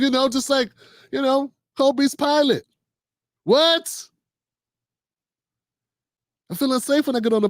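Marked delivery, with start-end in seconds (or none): 3.4-4.1 mock disbelief. Mock disbelief at pilot remark.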